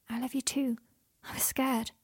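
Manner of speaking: barely voiced reply; soft whisper. A narrator's reading. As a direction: whisper it close, shaken. Son answers father.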